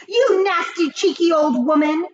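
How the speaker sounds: put-on a father yelling insults at the old woman; high furious insult; shouted. A narrator's reading. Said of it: "shouted Mister".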